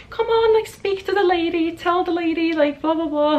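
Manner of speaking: put-on high, singsong nudging of a shy child; imitating parents urging their kid to speak up; playful mock parent pressure; coaxing parent.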